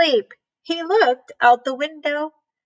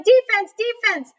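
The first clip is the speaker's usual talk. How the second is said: higher mock yell from the sideline; mild yelling; 7 semitones higher than usual. A narrator's reading. Dad yelling at the team from the sideline. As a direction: call it out to the team. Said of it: "Froggy's dad was yelling".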